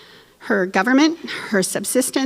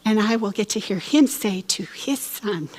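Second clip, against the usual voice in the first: usual speaking voice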